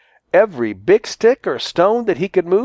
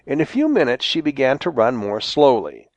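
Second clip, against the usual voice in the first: ordinary delivery, nothing marked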